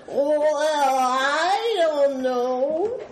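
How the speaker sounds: very slow, stretched-out geek drawl; hammed-up geeky hemming. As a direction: draw every word way out like a mock nerd. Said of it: "That's my geeky voice".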